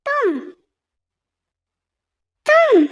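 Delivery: high startled तुम; jolted at the sight of her; shocked. Acted. Son finds the girl in his house, shocked.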